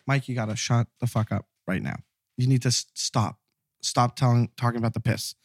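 low, hushed and urgent; hushed warning. Retold or imitated. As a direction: hiss a warning to keep quiet. Frank hushing him about the smell.

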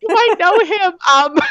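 high giggly echo; teasing echo, laughing; giggly tease. Real, not acted. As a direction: laugh it back at her. Guest laughing back.

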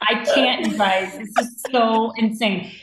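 normal talking voice

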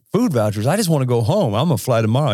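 retold rant, voice lifting in pitch; imitated going off. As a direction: imitate an affronted stranded passenger. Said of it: "off on the Delta people".

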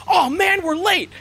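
put-on high excited yelp of late kids; kids running late. GM voicing kids who are late, high excited yelp. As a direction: kids calling out they're late.

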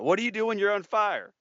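loud, high question to the room; calling out to crowd. Retold or imitated. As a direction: quiz the audience like a teacher. Speaker quizzing an audience.

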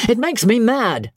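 high-pitched exasperation; angry howl. A narrator's reading. George complains about his sisters' piano playing, exasperated. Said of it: "George howled from the sofa".

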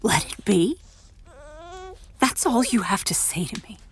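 whispered, bitter disbelief; hurt whisper. Acted. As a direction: breathe it, wounded. Unvoiced, hurt.

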